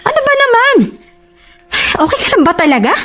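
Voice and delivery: high, exasperated complaint; upset quarrelling. Acted. Girlfriend accusing boyfriend of picking a fight, exasperated. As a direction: protest hurt and indignant, pitched well up.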